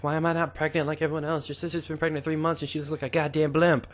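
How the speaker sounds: high-pitched impression of a complaining wife; mock high, worried wife voice; fretting wife. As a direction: pitch it up as a wife fretting over her pregnancy. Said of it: "she was nervous for the longest".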